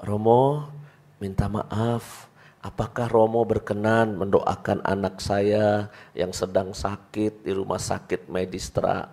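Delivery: soft, slow, humbled plea. Retold or imitated. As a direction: beg the priest meekly and softly. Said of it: "Ini nadanya turun". (suara merendah dan pelan)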